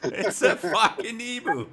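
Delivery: mock shout. Quoting the ostrich video man, mock shouting. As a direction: bellow it like the man in the clip.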